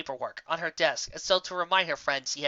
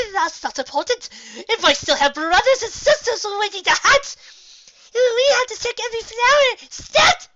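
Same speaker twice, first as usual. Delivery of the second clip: high, loud, frantic rush; pony panicking to save flowers, frantic; frantic panic; 9 dB louder than usual. Acted. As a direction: scramble in a shrill panic. Ponies panic over caterpillar.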